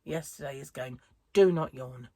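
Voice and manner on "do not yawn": firm, louder self-order; stern inner command. Retold or imitated. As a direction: tell yourself off sharply. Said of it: "my inner monologue from yesterday is going".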